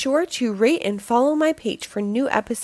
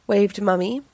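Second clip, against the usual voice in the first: ordinary delivery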